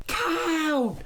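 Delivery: high startled scream; the wife screams on seeing the face. A narrator's reading. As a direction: shriek it at the sight of him. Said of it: "the wife screamed".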